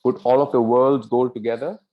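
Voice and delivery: loud sweeping claim, lifting at the end; emphatic flattery. Acted. First daughter repeating her praise to the king, emphatic. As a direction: declare it loudly and grandly.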